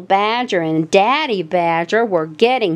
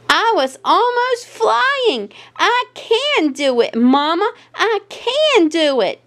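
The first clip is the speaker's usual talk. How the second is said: put-on much higher, proud childlike voice; bursting with pride; proud and excited; 10 semitones higher than usual. A narrator's reading. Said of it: "called Daisy proudly".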